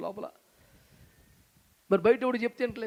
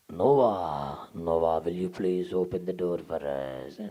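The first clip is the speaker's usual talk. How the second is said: much lower, very slow, drawn-out plea; a ghostly deep begging at the door; pleading crowd; 14 semitones lower, much slower than usual. Acted. People outside the ark begging Noah, deep and slow.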